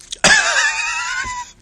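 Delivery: very high, loud burst of laughter; real squealing cackle, sustained; shrieking laugh. Real, not acted. Vlogger cracking up over the cards, shrieking with laughter.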